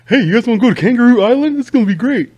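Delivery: high, fast, loud mock tourist; naive cheerful tourist. Mocking a tourist inviting friends to the island, high, fast and eager. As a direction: bounce in all innocent.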